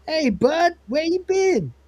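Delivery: wrong voice. Retold or imitated. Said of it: "Uh, no, sorry, Lister says".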